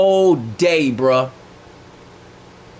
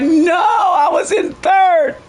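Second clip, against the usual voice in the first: anguished shout; 12 semitones higher, 4 dB louder, much slower than usual. Retold or imitated. Dropping in rank, outburst.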